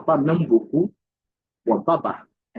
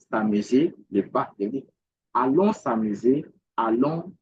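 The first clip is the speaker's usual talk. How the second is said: ordinary talk, nothing marked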